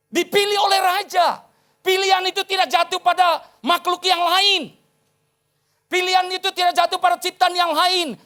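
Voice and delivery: high, loud, emphatic preaching; preaching at full force. Real, not acted. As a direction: proclaim it to the congregation, high and forceful. (khotbah berapi-api, lantang dan tinggi)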